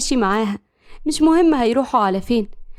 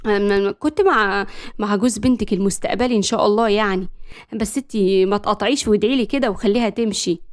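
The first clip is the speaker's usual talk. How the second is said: usual speaking voice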